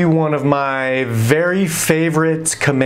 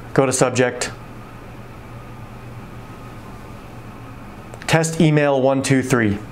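dictating; 2 semitones lower, 5 dB quieter than usual. Acted. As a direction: spell out each command for the computer. Dictating email to speech software.

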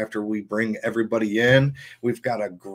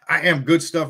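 ordinary delivery

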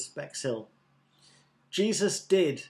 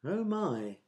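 higher-pitched soft sigh of dismay; 4 semitones higher, 4 dB quieter than usual. A narrator's reading. Crowd hearing that the priest walked away, sighed dismay. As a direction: sigh it, starting high and falling away. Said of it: "sighed the crowd".

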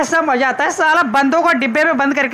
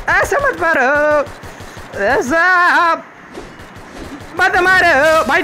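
slow, higher-pitched protest; drawn-out pleading; 3 semitones higher, much slower than usual. Real, not acted. Player under fire begging enemies to stop, drawn-out plea. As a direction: wail for them to stop shooting.

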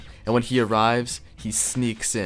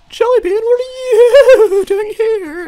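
much higher, much louder falsetto shriek, slowed; hammed-up squealing surprise; shocked squeal; 11 dB louder, slower than usual. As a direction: squeak the name out in startled falsetto, laughing through it. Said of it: "this man was like".

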